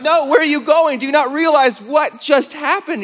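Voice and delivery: very high, loud incredulous outburst; freaked out. Retold or imitated. Man to healed villager walking away, incredulous yelling. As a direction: call after them in acted disbelief. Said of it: "I'm, like, so freaked out".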